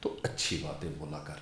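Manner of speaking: hushed, tender imitation of his mother; gentle motherly advice. Mother's advice voiced in story.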